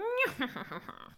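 high evil villain cackle; mustache twirling villain. Retold or imitated. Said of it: "twirling his mustache, going".

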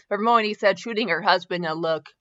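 ordinary talk, nothing marked

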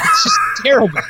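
high hammed-up cry of despair; mock wail. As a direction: wail it like it's a tragedy.

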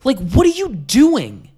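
high, loud, slow outrage; disbelieving outrage at salesmen; exasperated. Retold or imitated.

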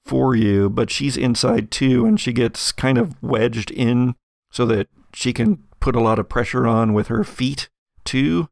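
ordinary delivery

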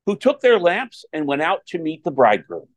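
solemn proclaimed scripture reading; Gospel reading. Acted. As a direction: read it out like a lector at Mass.

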